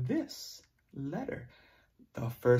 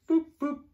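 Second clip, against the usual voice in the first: button boops; 6 dB louder than usual. Pretend. Pressing a pretend button.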